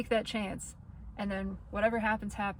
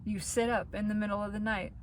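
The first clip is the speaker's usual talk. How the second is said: ordinary talk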